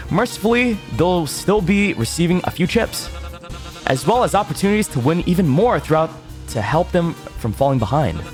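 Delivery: big game show promo voice; booming prize announcer. Acted. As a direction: sell it like a TV trailer.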